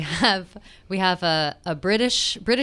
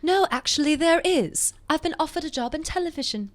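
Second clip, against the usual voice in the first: British Bridget voice; 6 semitones higher than usual. Acted. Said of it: "I'll be Bridget".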